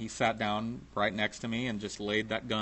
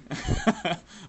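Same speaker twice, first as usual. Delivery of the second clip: short laugh; 7 semitones higher than usual. Real, not acted. Laughs mid-story.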